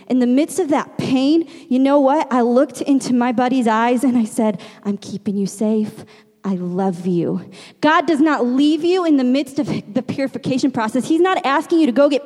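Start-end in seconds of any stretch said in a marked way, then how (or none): 4.8-7.5 put-on lower, gentle voice to a frightened child; retold motherly soothing; tender reassurance. Mother reassuring son in pain.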